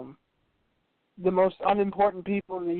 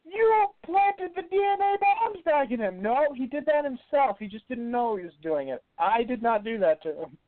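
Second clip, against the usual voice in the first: high mock argument; 9 semitones higher than usual. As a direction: voice the character, much higher. S1 acts out dialogue.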